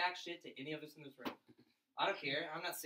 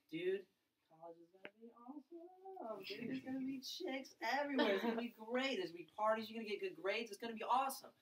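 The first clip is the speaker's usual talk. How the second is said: mock bro voice, higher and excited; hyped older brothers; 5 semitones higher than usual. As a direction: gush like a pumped-up big brother. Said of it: "they were like".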